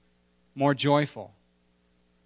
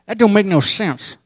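higher, louder, through closed teeth like Delmar; Delmar impression; 7 semitones higher, 10 dB louder than usual. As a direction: say it without opening the mouth. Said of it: "he's like".

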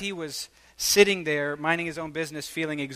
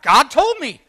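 blurted confession; 9 semitones higher, 10 dB louder, slower than usual. Retold or imitated. Blurting the prophecy to a stranger.

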